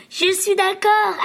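high, slow tender yes; gentle agreement. A narrator's reading. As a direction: agree warmly with your daughter. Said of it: "acquiesça maman".

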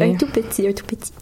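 hushed, wry little echo; joking whisper. Real, not acted. Host echoing the joke about initiation, whispered. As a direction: whisper it as a sly understatement.

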